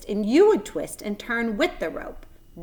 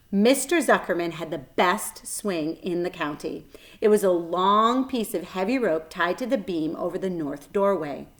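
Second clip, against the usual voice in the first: usual speaking voice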